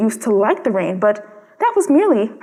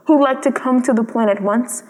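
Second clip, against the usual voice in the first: ordinary delivery, nothing marked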